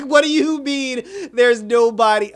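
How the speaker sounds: loud, high, slow shout; incredulous shout. Real, not acted. Fan yelling at the show about nobody helping, loud disbelief. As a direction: yell the question in disbelief. Mocking plot hole.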